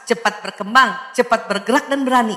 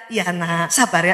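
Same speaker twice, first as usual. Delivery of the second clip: put-on gentle hush to baby; 7 semitones lower, 4 dB quieter than usual. Mother calming baby softly.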